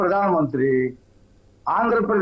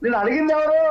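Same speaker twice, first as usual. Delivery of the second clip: sharp, high, heated question; real irritation, pitched up; worked up; 7 semitones higher than usual. Real, not acted. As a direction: cut the rival off angrily. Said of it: "ఓ రెచ్చిపోతావేంటి".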